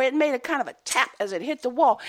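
ordinary delivery

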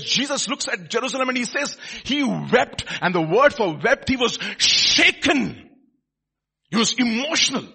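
loud, high-pitched, driving proclamation; full-force preaching. Real, not acted. Preacher tells people genuine prophets will weep, full-force preaching. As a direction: preach it hard, stretching key words.

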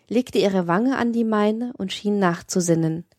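plain reading voice

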